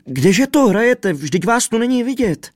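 high, loud wail of despair; hysterical, screechy pitch all through; frantic raving. A narrator's reading. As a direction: rage and lament at the missing friends. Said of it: "Běsnil a bědoval".

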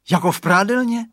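put-on little boy thrilled, rising "Jako v prádelně?"; cheering child. A narrator's reading. Radovan echoing his father about the fog, delighted cheer. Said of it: "zajásal malý Radovan".

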